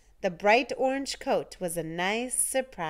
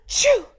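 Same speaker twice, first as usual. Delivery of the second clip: gasping intake then a huge loud choo; giant sneeze; 9 dB louder than usual. A narrator's reading. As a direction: sneeze it enormously. Snowman sneezed a gigantic.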